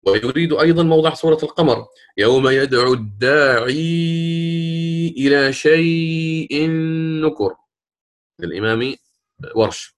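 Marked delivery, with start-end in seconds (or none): 2.2-7.5 very slow chant with long-held sounds; Quran recitation. Shown as a demonstration. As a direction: intone the verse, stretching each madd. معلم يتلو آية مستشهدا. (ترتيل بطيء بمدود طويلة)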